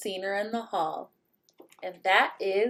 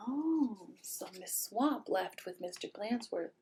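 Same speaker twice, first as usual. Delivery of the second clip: whispering; 13 dB quieter than usual. Real, not acted. Hushed narration aside.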